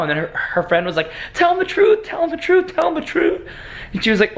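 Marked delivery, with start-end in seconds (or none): 1.3-3.5 a friend's high, urging voice, repeated; high pleading urging. Retold or imitated. Imitating the friend urging the girl to tell the truth, high-pitched. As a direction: nudge her to come clean, pitched up.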